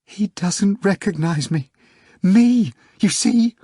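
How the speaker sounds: high, tearful wail. A narrator's reading. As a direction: wail it out, heartbroken. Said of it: "wailed the secretary".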